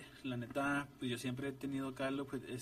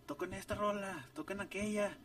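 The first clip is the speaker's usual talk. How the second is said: imitated crowd shouts; 7 semitones higher than usual. Fans requesting songs from the band, mild mock shout. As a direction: mimic fans calling out requests in a higher voice. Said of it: "Y luego nos gritaban".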